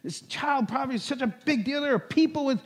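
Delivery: high, loud and urgent retold rant; fired up. Joe to his pastor about hungry kids, fired up and urgent. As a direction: plead hard for the hungry kids. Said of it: "He's just all fired up".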